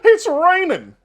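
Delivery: loud, high-pitched cartoon dog holler; Goofy. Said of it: "Goofy will be like".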